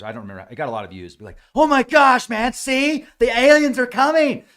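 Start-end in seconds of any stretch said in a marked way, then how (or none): 1.5-4.4 high, loud hammed-up panic; mock alarmed believer. Viewer reacting to a spaceship drone video, hammed-up alarm. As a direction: mimic a gullible viewer freaking out at the top of the voice. Mimics excited video commenters.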